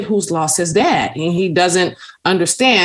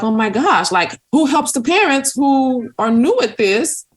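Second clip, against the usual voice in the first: exasperated; 9 semitones higher than usual. Retold or imitated. Exasperated parent, retold thought.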